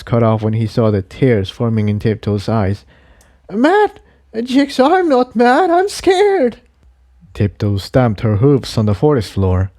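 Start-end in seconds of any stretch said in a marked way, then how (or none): 3.5-6.6 put-on very high, pleading young mare voice; a frightened mare bursting out to her friend; scared mare cries out. A narrator's reading. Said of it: "wanted to scream at him".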